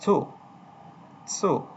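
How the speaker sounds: "সু" said as Japanese tsu, t running into s; model pronunciation of tsu. Shown as a demonstration. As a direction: model "সু" as a lone syllable, tsu, for learners to repeat. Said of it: "এটার উচ্চারণ হচ্ছে কি".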